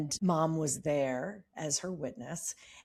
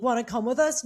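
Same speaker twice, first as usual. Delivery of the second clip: sarcastic; 6 semitones higher, 6 dB louder than usual. Retold or imitated. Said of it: "It was so sarcastic".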